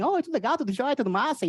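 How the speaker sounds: high, sing-song Brazilian chatter; Brazilian Portuguese lilt. Retold or imitated. Comic mocks Brazilians' cheerful rhythmic speech, sing-song. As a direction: bounce it like a samba. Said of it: "it's like they're dancing when they're talking".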